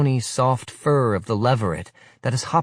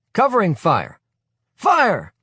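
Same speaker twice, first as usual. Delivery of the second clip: high, loud drill-ground yell; shouted command; 12 semitones higher, 6 dB louder than usual. Acted. Leader ordering boys to fire, loud barked command. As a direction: bark the fire order down the line.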